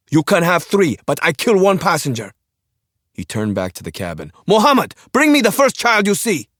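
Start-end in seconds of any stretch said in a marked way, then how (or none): 0.1-2.3 sharp high scream; frantic scream at the pilot. Acted. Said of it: "Jelak screamed every word". 4.5-6.5 sharp high scream; frantic scream at the pilot. Acted. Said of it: "Jelak screamed every word".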